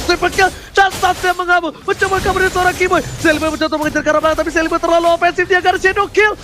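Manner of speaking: fast, rising esports play-by-play; fighting game announcer. Real, not acted. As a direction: rattle off the action as it happens. Rapid live play-by-play.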